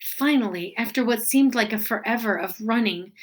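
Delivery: ordinary delivery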